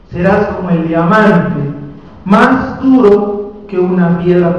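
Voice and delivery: very slow, loud declamation; proclaimed scripture. Acted. Preacher repeating God's promise from Ezequiel, proclaimed. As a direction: stretch each phrase out with force. Declaims the verse a second time.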